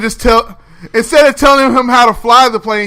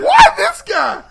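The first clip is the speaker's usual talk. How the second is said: exasperated shout; slower than usual. Retold or imitated. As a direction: throw up your hands and yell it. Exasperated mock outburst.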